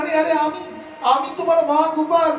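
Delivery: high, rushed astonished cry from a mother; a mother's startled, high plea. Acted. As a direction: gasp it out as a stunned mother. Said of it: "Malini অবাক হয়ে বলছেন".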